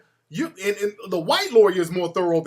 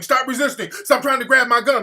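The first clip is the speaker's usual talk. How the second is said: loud, high mock police shout; urgent retold shouting; imitated cops yelling; 7 semitones higher, 8 dB louder than usual. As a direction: yell it out like staged police orders. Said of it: "All the cops start yelling".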